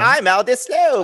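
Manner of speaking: high, drawn-out fake British accent; slow mock-British film impression; Forgetting Sarah Marshall impression. Host quoting a film character, hammed-up British accent. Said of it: "that's my forgetting Sarah Marshall".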